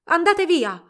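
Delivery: high, loud shout; frantic yell. A narrator's reading. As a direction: yell to drive them away. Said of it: "urlò Ilarion".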